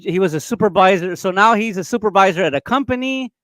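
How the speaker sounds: ordinary delivery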